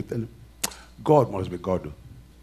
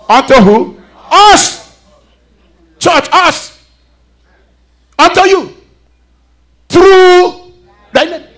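high, loud preaching shout; loud call-out; 11 semitones higher, 7 dB louder, slower than usual. Real, not acted. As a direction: belt it out to the church. Preacher calling out to congregation.

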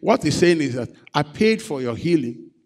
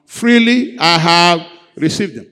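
louder, rallying the congregation; 4 semitones higher, 10 dB louder than usual. Shown as a demonstration. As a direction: lift it up, say it louder. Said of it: "Let's say it louder".

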